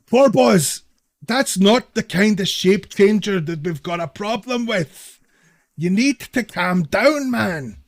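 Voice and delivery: loud, drawn-out appeal for calm; urgent remonstrating. Acted. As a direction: call out slowly to a panicking friend. Gnome urgently reasons with panicking companion.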